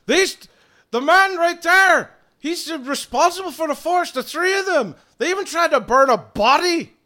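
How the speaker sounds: very high, loud outburst; furious accusation. Acted. Druid accusing trio of burning forest, furious shout. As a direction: shout the charge in outrage. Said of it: "who's been extremely pissed off".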